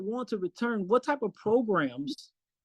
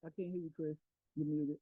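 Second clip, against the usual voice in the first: normal talking voice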